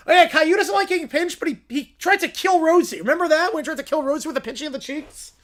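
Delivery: mock outrage. To the audience, mocking Caillou's hypocrisy with outrage. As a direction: ham up the disbelief.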